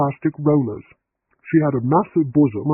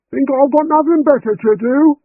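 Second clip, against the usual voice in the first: angry shout; 13 semitones higher, 8 dB louder than usual. A narrator's reading. Housekeeper shouting back.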